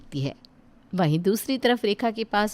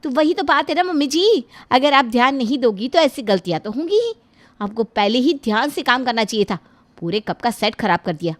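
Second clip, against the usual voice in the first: higher, scolding bahu over a broken cup; scolding daughter-in-law; 5 semitones higher than usual. Acted. Daughter-in-law scolding her mother-in-law for the broken cup, sharp and higher. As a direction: scold her like a careless child.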